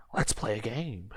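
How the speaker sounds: creepy whisper. Retold or imitated. Villain taunting, whispered.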